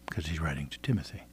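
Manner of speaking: soft whispered aside; whispering. Real, not acted. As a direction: drop to a whisper. Aside explaining the letter.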